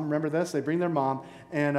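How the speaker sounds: normal talking voice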